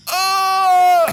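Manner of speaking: high, loud strangled wail; winded groan. Retold or imitated. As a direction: imitate gasping for air after a hard fall. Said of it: "You sounded like this fucking turtle".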